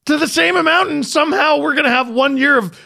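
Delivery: high, loud disbelief; ranting in disbelief, laughing at the end; incredulous shouting. Real, not acted. Incredulous rant about contracts.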